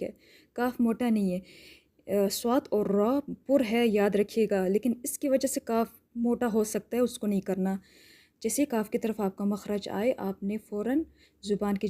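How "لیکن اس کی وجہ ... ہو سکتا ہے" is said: ordinary talk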